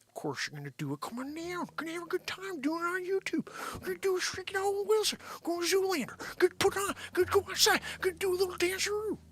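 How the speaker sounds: very high, rushed celebrity impression; Owen Wilson. Inviting everyone to hang out on YouTube, Owen Wilson impression. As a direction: run it together in a high put-on voice. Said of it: "a freaking little hangout with Owen Wilson".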